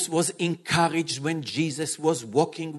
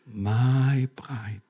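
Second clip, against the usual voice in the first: calm, loving voice of Jesus speaking to his bride; gentle reassuring Jesus. Acted. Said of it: "what the king told Jairus?".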